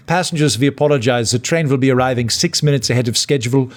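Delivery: imitation Swiss-German train announcement; German-accented announcer. Train guard apologizing to passengers over the speaker, German accent. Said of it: "a guy came over the speaker and he went".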